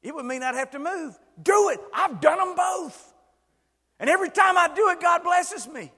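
high, loud preaching; preacher shouting down the listener's excuses; emphatic shout. Real, not acted. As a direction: fire back at the excuse.

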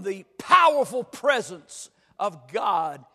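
loud, driving sermon delivery; full-force preaching. Real, not acted. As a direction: preach it hard, punching the key words.

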